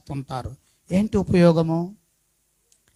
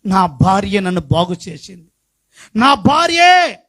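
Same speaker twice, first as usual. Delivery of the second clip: fiery preaching; 6 semitones higher than usual. Acted. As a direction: preach it out to the congregation. Preaching rises to full force.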